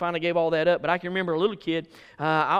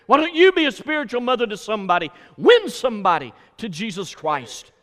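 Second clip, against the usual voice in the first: raised, urgent pulpit appeal; exhorting preacher; 4 semitones higher, 4 dB louder than usual. Real, not acted. Preacher challenges church to be spiritual parents, fervent exhortation. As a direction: press the challenge on the listeners.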